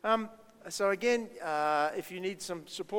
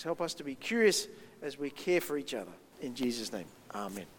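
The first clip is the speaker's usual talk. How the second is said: steady, low prayer voice that sinks at the amen; closing prayer; 5 semitones lower than usual. Acted. Pastor praying with the children after the talk, low and steady. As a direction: pray simply for the congregation.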